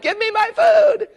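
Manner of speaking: high, loud frustrated yell; screaming inside. Retold or imitated. Driver waiting at the window, mock frustrated yell. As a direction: let it burst out, desperate for the food. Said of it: "I'm screaming inside".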